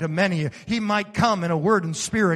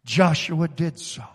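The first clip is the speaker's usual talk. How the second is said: ordinary talk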